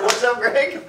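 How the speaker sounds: laughing quote. Retold or imitated. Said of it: "You just said".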